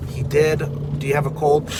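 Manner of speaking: usual speaking voice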